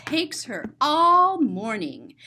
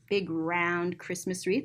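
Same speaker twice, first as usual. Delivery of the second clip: plain reading voice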